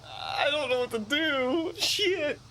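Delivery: high-pitched whiny wail; mock despair. Stuck gamer with no good answer, whiny mock despair. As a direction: wail helplessly like you're about to cry. Stuck in game dialogue, wailing.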